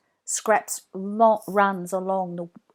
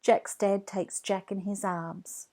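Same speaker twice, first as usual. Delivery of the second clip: usual speaking voice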